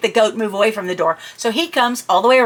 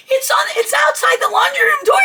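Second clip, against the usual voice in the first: incoherent panic. Retold or imitated. Explaining where the goat is, frantic shout. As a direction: blurt it out too scared to make sense. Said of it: "I apparently wasn't being coherent".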